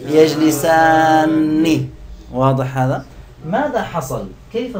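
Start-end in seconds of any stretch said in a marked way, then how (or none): 0.1-1.9 يجلسان stretched out very slowly and loud, final syllable held; slow emphatic model word; slow stretched demo. Shown as a demonstration. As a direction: draw يجلسان out for students to copy. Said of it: "إذا نعيد".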